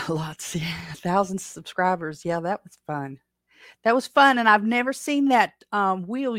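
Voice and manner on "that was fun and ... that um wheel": higher, louder and eager; bursting with delight; excited. Real, not acted. Excited recalling the wheel.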